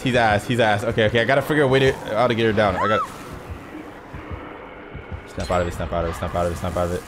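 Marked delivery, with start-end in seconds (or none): 5.3-7.0 low, soft, rhythmic repeating; muttered self-talk. Real, not acted. Player urging self to focus, low quiet mutter. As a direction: chant it under your breath.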